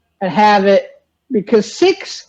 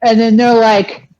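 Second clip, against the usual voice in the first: normal talking voice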